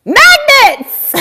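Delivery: high, loud squealed reveal; excited squeal. Real, not acted. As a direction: squeal the word with delight. Revealing swag magnets.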